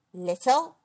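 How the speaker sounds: loud, indignant rising question; affronted giant; cross. A narrator's reading. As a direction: snap it back in offence. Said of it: "said Angus crossly".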